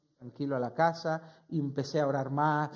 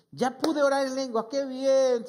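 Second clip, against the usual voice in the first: higher, louder glad exclamation from the pastor; pastor rejoicing; 8 semitones higher, 6 dB louder than usual. Retold or imitated. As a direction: retell the pastor's happy reply with lift. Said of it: "se alegró". (el pastor se alegra, voz más alta y fuerte)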